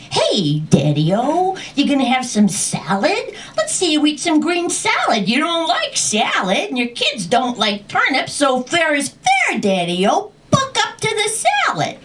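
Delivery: high, rising mock goading; taunting challenge. Dragon daring dad to eat salad, high-pitched taunting.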